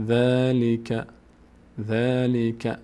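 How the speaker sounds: ذَلِکَ with long drawn-out first vowel; slow syllable demo. Shown as a demonstration. Teacher showing students how the word reads, slow syllable demo.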